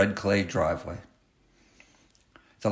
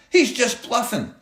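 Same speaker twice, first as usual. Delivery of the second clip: put-on high boy's voice. A narrator's reading. Sixth grader shouts from back.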